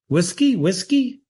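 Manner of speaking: two rising notes, like a bird; rising bird call. A narrator's reading. Johnny begging the bar for whiskey, rising two-note call. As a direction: sing it as a two-note question. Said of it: "Two notes on a rising scale".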